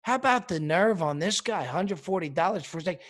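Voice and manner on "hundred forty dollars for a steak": usual speaking voice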